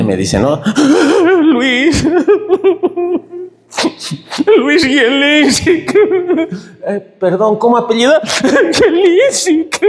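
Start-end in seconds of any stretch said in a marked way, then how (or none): 0.6-1.4 high gasp dissolving into laughter; gasping giggle. Retold or imitated. Laughing while giving name. 1.4-9.8 much higher, giggling out the name; high, tearful laughing; laughing through tears. Retold or imitated. Laughing, crying while giving name.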